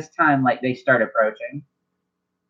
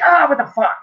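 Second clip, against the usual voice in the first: higher, sudden startled yelp; 12 semitones higher, 4 dB louder than usual. Real, not acted. GM startled by a weird sound behind him, higher yelp.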